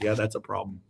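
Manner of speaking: low, soft matter-of-fact answer; understated reply. Retold or imitated. Psychologist answers a panicking patient, understated. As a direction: answer quietly and low, making little of it.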